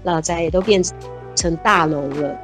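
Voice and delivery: usual speaking voice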